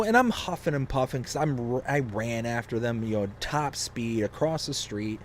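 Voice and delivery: normal talking voice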